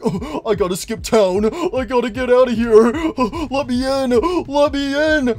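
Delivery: high, loud frantic robot voice; building panic; panicked Golden Freddy. Retold or imitated. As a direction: beg to escape, panicking. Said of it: "he's like".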